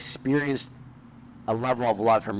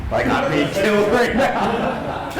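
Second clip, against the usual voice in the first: breaking into high, slow laughter mid-line; laughing; 11 semitones higher, slower than usual. Real, not acted. Picturing the neighbors hearing his sobs, laughing high. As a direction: laugh through the words as the memory hits. Laughing at own loud crying.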